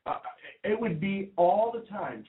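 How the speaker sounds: ordinary delivery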